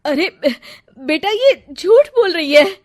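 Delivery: high, loud pleading protest; mother protesting the bride's lie to her son. Acted. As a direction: protest to him, high and urgent.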